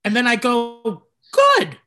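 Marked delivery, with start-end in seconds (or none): 1.3-1.8 loud, high-pitched mock retort; savage comeback. Comic retelling his retort to a heckler about her dad. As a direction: fire it back as a cruel punchline.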